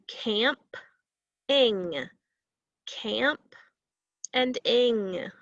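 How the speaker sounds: CAMP, pause, ING, each syllable set apart; syllables broken apart. Shown as a demonstration. Teacher showing pupils two syllables, broken apart. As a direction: split camping into "camp" and "ing" with a clear gap. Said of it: "break those syllables apart".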